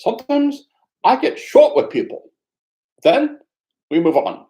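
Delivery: high, loud, strained imitation of the Dalai Lama's Tibetan accent; a hammed-up Tibetan accent, high and loud. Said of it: "I can't do the accent really well".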